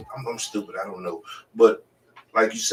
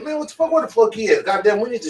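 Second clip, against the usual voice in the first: much higher, indignant cries; retold outrage; 12 semitones higher, 6 dB louder, faster than usual. Retelling hearing her baby daddy hit her friend, high indignant outrage. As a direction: fire off the questions in high outraged disbelief.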